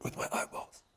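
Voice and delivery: groggy whisper. Real, not acted. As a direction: whisper it, drained and numb. Whispered replies after root canal.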